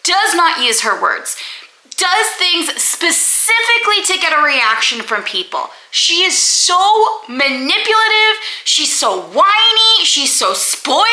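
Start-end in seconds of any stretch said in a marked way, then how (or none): 2.0-11.0 angry rant. Real, not acted. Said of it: "I was so mad".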